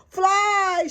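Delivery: high, loud shriek. A narrator's reading. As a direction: shriek in panic. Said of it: "shrieked Henny Penny".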